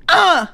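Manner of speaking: high-pitched campy grunt; hammed-up flamboyant exertion noise; flamboyant lifting grunt. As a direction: squeal out a camp lifting grunt. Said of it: "hella flamboyant as we lift".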